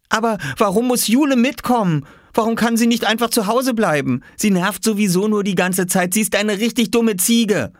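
high-pitched indignant grumbling; whining little boy. Acted. Oskar complaining to Viktor about Jule, whining.

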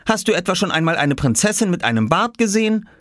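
put-on high, challenging boy's question; objecting child. Boy argues with mother about the beard, childish challenge. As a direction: push the rhetorical question in a child voice. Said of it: "erwiderte Oskar".

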